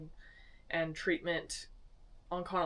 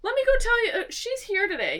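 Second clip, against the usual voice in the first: much higher, loud chirpy announcement; bubbly burst; 9 dB louder than usual. Retold or imitated. Office manager remembering a doctor is in, high and loud. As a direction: pop up high and loud with sudden cheer. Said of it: "she's telling me, like".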